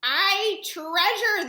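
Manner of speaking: loud, very slow crew shout; pirates shouting. A narrator's reading. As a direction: roar it together as the crew. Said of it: "the others shouted".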